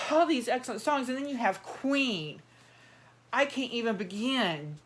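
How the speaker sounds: normal talking voice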